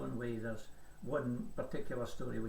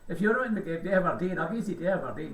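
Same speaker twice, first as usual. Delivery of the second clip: put-on posh voice, pitched higher; playful posh accent, higher; 6 semitones higher, 11 dB louder than usual. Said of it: "this is my posh, posh accent".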